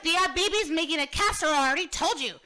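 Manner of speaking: loud, high imitation of an annoyed mom; mock exasperated mom. Mom to hungry kids on a road trip, mock exasperated. Retells mom's brush-off, acted.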